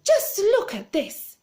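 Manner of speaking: high, loud and slow; excited shout. Acted. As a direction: call it out slowly, bursting with delight. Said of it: "she was shouting".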